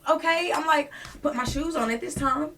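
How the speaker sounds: loud, high, worked-up retelling; agitated confusion. Retold or imitated.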